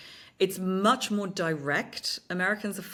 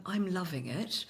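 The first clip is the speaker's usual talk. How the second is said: stiff, careful British reading, quiet and slow; British delivery with every word neatly sounded; British accent; 5 dB quieter than usual. Shown as a demonstration. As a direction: say it softly, too properly British. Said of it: "you just can't say".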